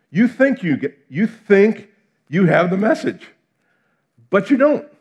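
building from loud to a high, loud rebuke; rising rebuke. Retold or imitated. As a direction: press harder on each you. Voicing Jesus rebuking crowd.

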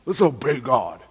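sneering churchy singsong; mocking pious cliché. Preacher mocking religious garbage, sneering singsong. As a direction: parody the empty slogan with scorn. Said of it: "that's religious garbage".

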